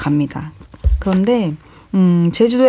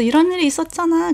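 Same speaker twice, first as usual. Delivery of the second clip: high-pitched, mock-amused quote; retold laughing recollection; 10 semitones higher than usual. Retelling a funny story about the brother, mock-laughing. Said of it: "하하하 웃으면서".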